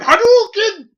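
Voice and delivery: big anime battle yell; Hadouken shout. Retold or imitated. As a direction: shout it like a fighting game move. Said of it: "I'm sitting there going".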